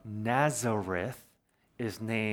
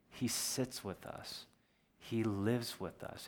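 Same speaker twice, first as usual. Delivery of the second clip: softer, slow, near a whisper; hushed and tender; 6 dB quieter than usual. Acted. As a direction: let the words sink in, hushed. Tender sermon climax.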